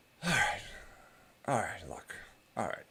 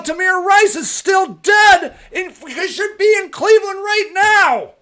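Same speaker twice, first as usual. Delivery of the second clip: angry shout; 9 semitones higher than usual. Real, not acted. Ranting at viewers about Tamir Rice, furious shout. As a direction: yell it, furious at the injustice.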